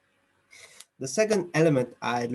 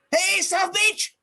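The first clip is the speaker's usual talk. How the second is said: very high-pitched, drawn-out call; emotional exclamation; 3 dB louder, slower than usual. Shown as a demonstration. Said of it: "emotions. The voice going".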